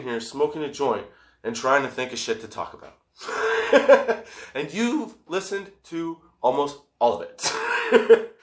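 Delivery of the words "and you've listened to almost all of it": laughing. Real, not acted. Laughing at himself.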